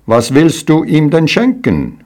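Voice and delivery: high, jeering question; mocking. Shepherds jeering at the fool, mocking and high. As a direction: taunt him, pitched well up. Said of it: "spotteten sie".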